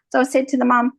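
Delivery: usual speaking voice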